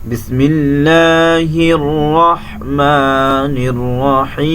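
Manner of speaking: slow, drawn-out tajweed chant; measured Quranic recitation, each verse held. Shown as a demonstration. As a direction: recite with long melodic stretches. Teacher recites surah in tajweed.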